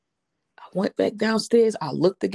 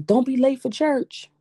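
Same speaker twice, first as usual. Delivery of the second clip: lightly voiced husband calling a reminder, higher and slower; raised pitch, drawn-out reminder; husband's reminder, quoted; 7 semitones higher, 3 dB louder than usual. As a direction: gently imitate a spouse's parting reminder.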